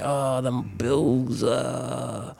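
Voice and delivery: slow, drawn-out weary groan; weary sigh. Retold or imitated. Worried dad groaning about the bills, slow and weary. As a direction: drag it out like the bills crush you.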